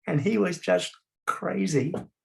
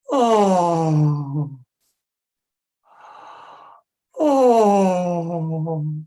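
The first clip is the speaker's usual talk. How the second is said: long loud open-throated sad oh; loud drawn-out sad clown moans; sad clown sighs; 3 semitones higher, 8 dB louder, much slower than usual. Shown as a demonstration. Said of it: "big sigh, everybody".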